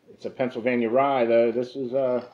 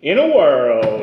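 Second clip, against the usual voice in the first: loud, booming trailer opener; movie trailer guy; 7 dB louder, slower than usual. Pretend. Host jumps in on the whiskey pitch, loud trailer voice. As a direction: intone it like a movie announcer. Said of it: "I can do the movie guy".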